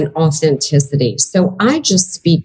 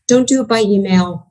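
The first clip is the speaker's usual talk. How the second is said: ordinary talk, nothing marked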